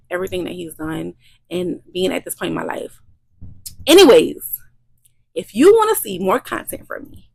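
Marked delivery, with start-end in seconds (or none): 3.9-4.6 a sudden loud burst; loud transition. Real, not acted. Vlogger snapping back to her viewers, loud. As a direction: blast the word to change gears. Switching to the outro.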